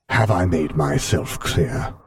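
cold, measured imperial authority; Darth Vader voice. Acted.

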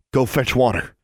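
curt order. A narrator's reading. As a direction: order her off firmly. Maximilian orders his sister away.

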